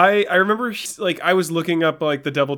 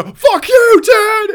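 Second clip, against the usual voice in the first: very high, loud mock scream; imitated shout; 11 dB louder, much slower than usual. Fan imitating the hero screaming at his dad, high shout. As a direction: yell it full out as the defiant son. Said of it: "Like, he just shouts it".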